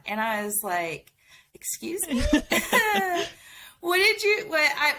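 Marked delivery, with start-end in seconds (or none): 1.5-4.9 high, stammering mock disbelief; retold bewilderment. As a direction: act out being caught off guard, half laughing. Retold shocked reply to boss's request.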